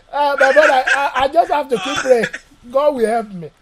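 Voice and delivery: ordinary delivery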